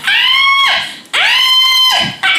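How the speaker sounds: high-pitched mock screams; long, high comic shrieks; imitated screaming. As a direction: holler like a kid getting whooped. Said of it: "I'm screaming and hollering".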